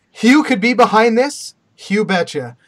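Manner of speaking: mock commercial voiceover, loud and punchy; lightly hammed-up ad read, projected; Ryan Reynolds voiceover. Retelling a rival's coffee commercial, mock voiceover. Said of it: "it has a voiceover of Ryan Reynolds".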